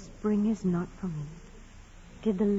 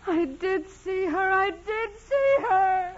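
building from firm to high, loud pleading; each repeat climbs higher; rising desperate insistence; 10 semitones higher, 4 dB louder than usual. Acted. Dying nun repeats testimony rising.

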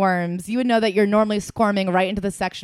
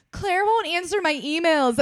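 higher, slow mock whine of a tattling coworker; whiny coworker; 10 semitones higher, slower than usual. Coworker tattling to the company heads, high slow mock whine. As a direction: play the petty complainer, dragging it out. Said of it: "being like".

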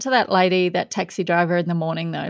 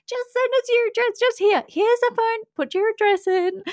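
much higher, friendly mock stranger voice; imitating eager strangers. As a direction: play the eager fan asking for the address. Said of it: "notes and messages, like, from".

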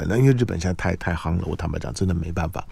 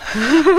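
quick rising laugh; bright laugh; 6 dB louder than usual. Real, not acted. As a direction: laugh it off lightly. Host laughs at own remark. (轻快地笑一声)

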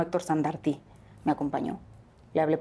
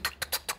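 soft whispered tuc tuc of a heartbeat; 10 dB quieter than usual. Retold or imitated. Mother recalling the ultrasound, whispered heartbeat sound. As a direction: breathe the heartbeat sound, voiceless.

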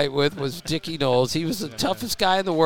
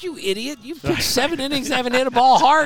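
a raised, higher mock scold at a pitcher; Dutch scolding; 10 semitones higher, 5 dB louder than usual. Pitching coach retold scolding his starter, mock exasperated. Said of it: "Dutch looks at him and goes".

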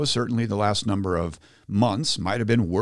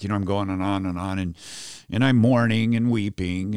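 ordinary delivery

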